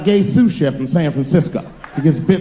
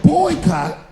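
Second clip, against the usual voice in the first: indignant rant; 11 semitones higher than usual. Retold or imitated. Ranting at reporter about boycott.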